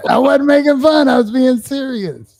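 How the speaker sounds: high-pitched protest. Real, not acted. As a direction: squeak out an indignant denial. Defends himself, pitch jumps.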